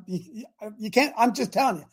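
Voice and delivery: ordinary talk, nothing marked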